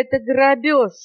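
ГРАБЁЖ exclaimed high; outraged parrot cry. A narrator's reading. Parrot accusing monkey of robbery, crying out. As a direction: protest in a high, indignant parrot voice. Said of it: "закричал попугай".

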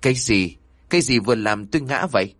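usual speaking voice

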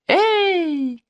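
high, drawn-out holler without much volume; acted call across camp. A narrator's reading. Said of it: "крикнула она на весь лагерь". (звонкий протяжный оклик на весь лагерь)

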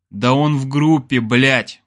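mildly weary exasperation; tired sigh. A narrator's reading. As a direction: say it with a touch of fatigue. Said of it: "устало вздохнул я".

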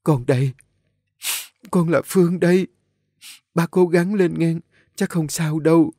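high-pitched tearful reassurance; sobbing. A narrator's reading. Nhã Phương embraces the dying woman, sobbing. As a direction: plead through sobs, pitched high. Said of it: "giọng nức nở".

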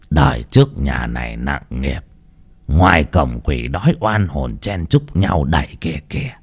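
low, soft foreboding voice; ominous old priest. A narrator's reading. Priest warns the family of hungry ghosts, low and ominous. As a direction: warn them darkly.

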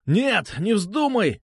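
high-pitched panicked protest; yelling. A narrator's reading. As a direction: yelp to stop someone. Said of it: "завопил он". (завопить в панике)

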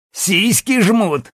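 high, drawn-out mocking question; crude jeering. A narrator's reading. Jackson teasing the woman about her chest, crude jeer. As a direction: stretch it out as a leering taunt. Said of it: "заржал опять Джексон".

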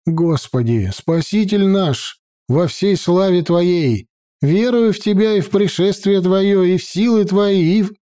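high, earnest prayer; prayer recital. A narrator's reading.

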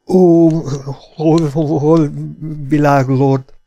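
high, loud frightened begging; pleading, teeth chattering. Acted. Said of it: "könyörgött fogvacogva". (magas, hangos, vacogó könyörgés)

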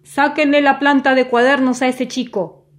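loud, high barked command; angry order. A narrator's reading. Salesman orders blue police soldiers, loud command. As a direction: order the police around furiously. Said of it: "y ordenó".

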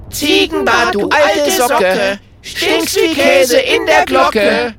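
loud, high, slow taunting chant; shouting at full lung. A narrator's reading. Friends taunt the wizard Ziegenbart, shouting a slow mocking rhyme. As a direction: bellow the rhyme to lure the wizard. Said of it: "rufen aus vollem Halse".

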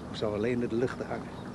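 ordinary talk

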